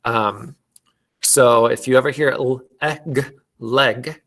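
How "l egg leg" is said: "l, eh, g" apart, then "leg" with short e; careful phonics "leg", vowel as in "bed"; sounding out. Shown as a demonstration. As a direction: segment "leg" with an open "eh", then blend. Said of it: "why they say it like leg".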